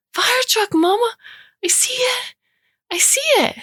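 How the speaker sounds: put-on very high excited child's howl; howling toddler. A narrator's reading. Toddler spotting fire trucks calls to mama, high-pitched howl. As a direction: cry it out high like a thrilled little boy. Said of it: "he howls".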